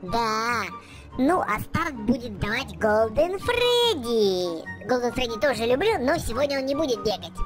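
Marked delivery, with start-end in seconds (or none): none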